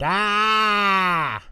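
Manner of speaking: high startled shriek; scream. A narrator's reading. Angelica shrieking at a spider, high startled scream. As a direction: jump at a spider. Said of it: "Angelica screamed".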